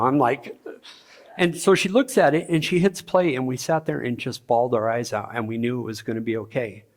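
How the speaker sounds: ordinary delivery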